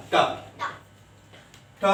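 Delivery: ठ repeated alone, a crisp retroflex puff; letter sound demo. Shown as a demonstration. Teacher drilling the sound of T for students, crisp ठ. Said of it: "sound इसकी ठ".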